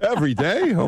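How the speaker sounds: high, incredulous question; can't believe it's daily; mock-astonished every day; surprised. Real, not acted. Host stunned by daily podcast.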